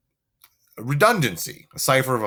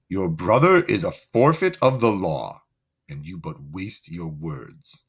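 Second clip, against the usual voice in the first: stern Angelo; 7 semitones lower than usual. Acted. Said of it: "do Angelo's voice".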